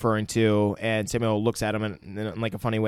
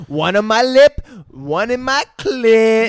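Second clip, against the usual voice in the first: high, louder, slowed mock valley girl; mock girly voice; 10 semitones higher, 7 dB louder, slower than usual. Friend imitating the girl listing piercings in a high mock voice. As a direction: drag it out like a ditzy girl bragging. Quoting girl's piercings, laughing.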